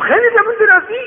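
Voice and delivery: very high loud yell; furious shout. Acted. Rich man enraged by the beggar's answer, furious shout. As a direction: explode at his cheek.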